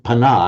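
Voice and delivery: "pana" as puh-NAH, first vowel short; second-syllable stress. Shown as a demonstration. Teacher explaining the English habit, "pana" stressed on second syllable. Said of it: "put the stress on the second syllable".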